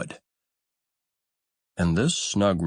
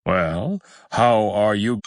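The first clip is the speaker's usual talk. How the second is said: louder, lightly voiced old duck; visitor duck greeting; 4 dB louder, slower than usual. A narrator's reading. Old duck visiting the mother on her nest, louder greeting.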